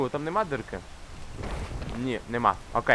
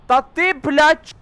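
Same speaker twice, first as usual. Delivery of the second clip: high, loud, slow exasperated cry; exasperated outburst; 12 semitones higher, 8 dB louder, slower than usual. Real, not acted. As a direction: burst out at the screen. Snapping at the game.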